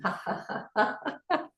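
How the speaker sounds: soft ha-has climbing high; quiet rising laughter; exercise laughter. Shown as a demonstration. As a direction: start breathy, then laugh up high. Laughter exercise while packing.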